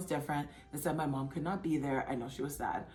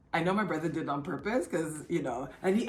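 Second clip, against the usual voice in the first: normal talking voice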